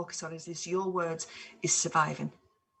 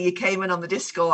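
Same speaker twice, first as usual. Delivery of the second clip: ordinary talk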